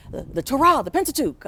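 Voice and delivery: retold class shout, half-performed; yelling out answers. Students calling out answers to the professor, mild mock yell. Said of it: "we yell out".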